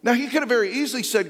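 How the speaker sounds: building pulpit preaching. Acted. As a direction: preach it home with conviction.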